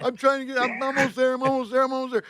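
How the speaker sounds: high, panicked reassurance; mock panicked promise over the phone; frantic. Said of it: "I go".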